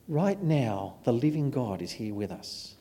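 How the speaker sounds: soft, measured appeal with long pauses; solemn preaching. Real, not acted. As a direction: let the closing challenge settle quietly on the listeners. Solemn closing appeal to congregation.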